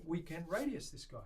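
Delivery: ordinary delivery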